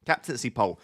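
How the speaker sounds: ordinary talk, nothing marked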